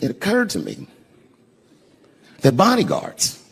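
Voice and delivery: ordinary delivery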